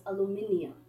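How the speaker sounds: "aluminium" with the extra i, al-yoo-MIN-ee-um; British "aluminium", ending in -ee-um; British pronunciation. Shown as a demonstration. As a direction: say "aluminium" the British five-syllable way. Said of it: "British English is. That's, that's what you say".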